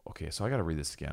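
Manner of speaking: usual speaking voice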